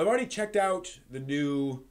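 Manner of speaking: usual speaking voice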